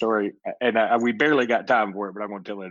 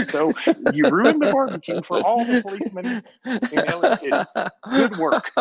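laughing mock scolding; 12 semitones higher, 3 dB louder than usual. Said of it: "And Dad said".

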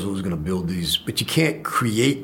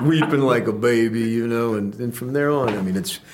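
usual speaking voice

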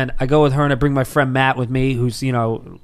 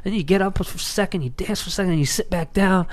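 friend retelling; 5 semitones higher, 4 dB quieter than usual. Retold or imitated. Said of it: "my buddy Matt explains it to me. He goes".

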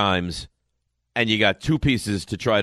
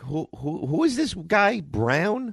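high, puzzled mock disbelief; baffled who-is-this; 7 semitones higher, slower than usual. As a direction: ask it like you've never heard the name. Mock baffled fan asking who.

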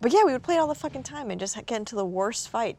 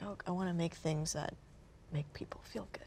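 wistful thought; 15 dB quieter than usual. Retold or imitated. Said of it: "it's like".

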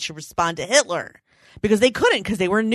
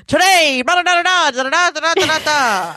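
high, loud newsreel voice; old radio announcer; 9 semitones higher, 6 dB louder than usual. Retold or imitated. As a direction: boom it out like a wartime newsreel. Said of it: "That's how it goes".

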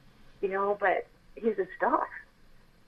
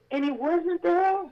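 dawning disbelief; 9 semitones higher, slower than usual. Real, not acted. Finds the angel gone.

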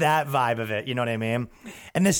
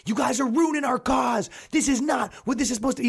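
high mock yell; 9 semitones higher than usual. Activist yelling at rioters, hammed-up shout. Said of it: "He was yelling".

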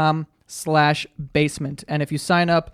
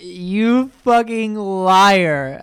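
very slow, drawn-out accusation, raised and loud; mock accusation; 5 semitones higher, 5 dB louder, much slower than usual. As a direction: stretch out every word as her exaggerated reproach. Mimicking the girl's accusation.

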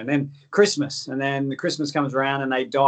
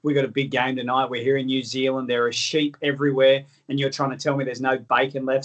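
normal talking voice

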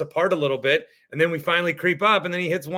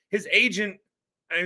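ordinary talk, nothing marked

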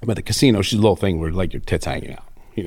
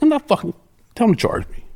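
mock defiant; 12 semitones higher than usual. Retold angry refusal to cop.

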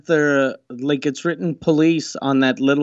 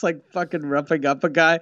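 usual speaking voice

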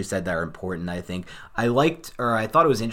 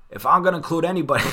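higher, louder, fast botched GM voice; crappy Gettleman impression; 8 semitones higher, 6 dB louder, faster than usual. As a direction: rush a clumsy impression of the GM, pitched up. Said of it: "I'll do my crappy Dave Gettleman accent".

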